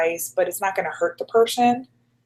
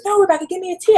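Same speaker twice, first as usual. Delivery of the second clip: Jackie insisting; 12 semitones higher, 3 dB louder, faster than usual. Retold or imitated. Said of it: "And she goes".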